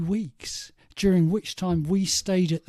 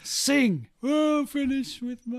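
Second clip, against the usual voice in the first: mock [name] prompt; 6 semitones higher, 5 dB louder, slower than usual. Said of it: "[name] nudging him".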